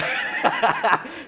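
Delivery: quick unvoiced laugh; breathy laugh. Real, not acted. Laughs after own joke.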